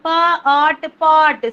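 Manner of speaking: each sound said loud and apart; phonics blending. Shown as a demonstration. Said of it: "phonics sound blending demo".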